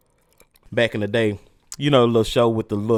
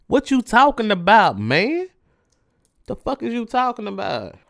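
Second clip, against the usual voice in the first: incredulous; 9 semitones higher than usual. Real, not acted. Mocking disbelief at quote.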